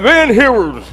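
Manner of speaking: a marshal's big rough call; booming court marshal calling a name. Retold or imitated. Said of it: "With that big rough voice".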